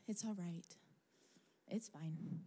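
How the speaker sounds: soft whisper, letting it go; whispered self-comfort. Retold or imitated. Giving up the search, whispering to herself resignedly. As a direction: hush yourself into accepting the loss.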